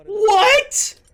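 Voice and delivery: very high, loud cry of shock; startled high yelp; shocked what. Real, not acted. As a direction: blurt it out, stunned. Shocked by sudden reveal.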